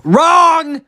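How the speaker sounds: very high, loud drawn-out yell; shouted verdict. Acted. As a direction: scream it out and hold it. Game buzzer-style interruption.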